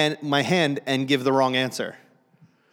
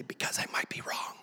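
hushed whispered reply; whispered answer in church; 13 dB quieter than usual. Retold or imitated. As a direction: whisper back to your wife. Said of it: "And I say".

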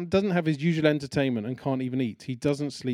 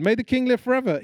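higher and louder, calling out; 11 semitones higher, 6 dB louder, faster than usual. Acted. Daniel calling up to the king from the den, loud. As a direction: call up from the pit to the king.

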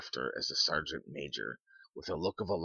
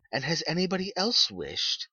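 high-pitched woman's voice, wondering; curious old lady; 13 semitones higher, 5 dB louder than usual. A narrator's reading. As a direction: voice an inquisitive wife, pitched well up. Old lady inquires further.

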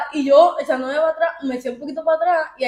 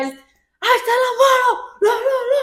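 high-pitched, drawn-out yelling; a laughing, hammed-up shout; imitated police shouting; 10 semitones higher, 3 dB louder, slower than usual. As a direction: mimic officers yelling frantically. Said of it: "empezaron a gritar".

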